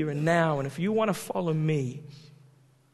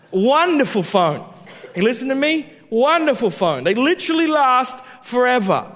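louder, higher gushing praise; mock enthusiasm; 6 semitones higher, 6 dB louder than usual. Real, not acted. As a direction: sell it like a fan. Mock-passionate praise of old phone.

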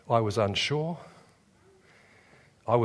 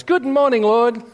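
much higher, bright sing-song greeting; cheery morning wife; 9 semitones higher, 5 dB louder than usual. Retold or imitated. Wife greets God at dawn, chirpy high sing-song. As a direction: chirp it like a born morning person. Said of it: "Merle wakes up and goes".

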